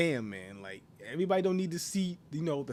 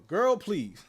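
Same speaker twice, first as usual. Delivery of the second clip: sassy quote; 4 semitones higher, 5 dB louder than usual. Retold or imitated. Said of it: "the dude's gonna start talking like that".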